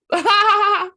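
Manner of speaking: real laugh, shrieking high and loud; loud burst of laughter. Real, not acted. Host cracking up at the guest's slogan, big high laugh. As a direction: let the laugh burst out full.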